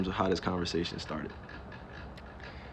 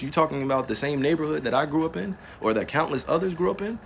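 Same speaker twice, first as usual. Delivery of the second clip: ordinary talk, nothing marked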